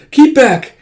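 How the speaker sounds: high, loud acted warning; frightened warning. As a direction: cry out to keep him away. Frightened girl warns him off.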